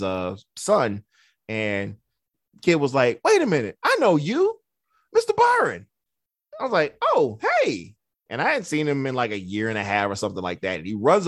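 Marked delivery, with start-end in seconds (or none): 3.2-5.9 high, louder mimicked kid, building to the name; excited recognition. Kid spotting his old therapist at a band competition, excited recognition. 7.0-8.0 high-pitched retold hello; surprised greeting. Therapist answering an old client who spoke first, surprised greeting.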